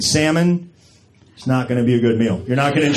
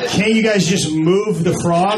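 slow dumb question; 5 semitones higher, slower than usual. Retold or imitated. Playing dumb with the attendant.

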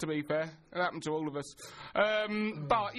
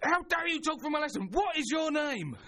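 hammed-up angry teacher telling off a pupil; mock angry teacher; 9 semitones higher than usual. Teacher scolding a chatty pupil, high hammed-up anger. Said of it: "she starts getting angry".

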